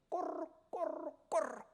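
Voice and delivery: rising gurgle, jumping high; imitated drowning gurgle. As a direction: mimic someone sinking under water. Imitating a drowning man going under. (물에 빠져 꼬르륵대는 소리 흉내)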